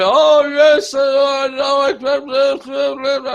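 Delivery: put-on high, loud doddering old man voice; 颤巍巍的老头, 调高声大; mock old man. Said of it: "they basically just come out and just go".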